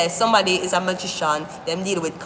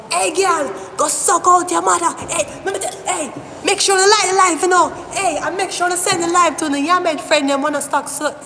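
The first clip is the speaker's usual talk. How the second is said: high, loud bossy street voice, bellowing orders; 9 semitones higher, 3 dB louder than usual. Acted.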